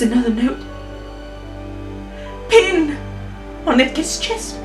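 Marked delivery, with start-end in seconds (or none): none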